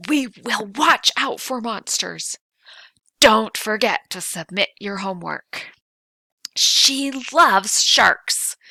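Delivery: loud and high with extra emotional punch; exclamation punch. Shown as a demonstration. Teacher demonstrating exclamations to the class, loud and excited. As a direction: sell each line like it deserves an exclamation point. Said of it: "that little extra emotional punch".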